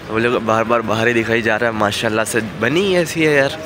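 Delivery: ordinary delivery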